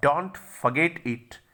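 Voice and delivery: Don't forget it stretched out, very slow, DON'T stressed; slow emphatic reminder. Shown as a demonstration. Teacher drilling a reminder to students, slow stress. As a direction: drag out Don't forget it, hit DON'T.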